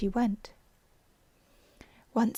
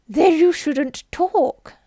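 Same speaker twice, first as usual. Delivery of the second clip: much louder, higher, slow snub; rude retort; 6 semitones higher, 10 dB louder, much slower than usual. Acted. Hatter rudely cutting off Alice, loud and high. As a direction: snap it back rudely and loud.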